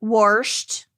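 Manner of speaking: "warsht" with an R before the SH; grandpa's "warsht". Retold or imitated. Granddaughter quoting her grandpa, "warsht" with an added R. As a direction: put an R into washed: "warsht". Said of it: "he said".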